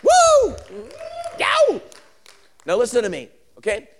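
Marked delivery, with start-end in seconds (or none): 0.0-1.8 very high, loud preacher's whoops; piercingly high celebratory holler; exultant whoop. Real, not acted. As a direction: let out two short ecstatic yelps. Whoops at the sermon's climax.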